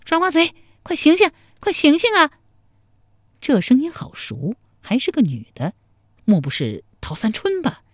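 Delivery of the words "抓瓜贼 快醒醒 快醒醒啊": put-on much higher, louder girl's voice rousing him; young woman calling. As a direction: call him awake as a feisty young woman. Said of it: "忽听有人叫他".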